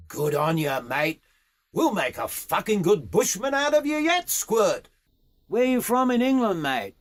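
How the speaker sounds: high, emphatic matey praise; blokey bush horseman, pitch lifting high; approving Aussie boss. Acted. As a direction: congratulate the young rider roughly.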